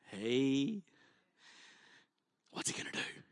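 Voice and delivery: secretive hushed aside; hushed whisper. Retold or imitated. Friends on the roof whispering to each other, hushed.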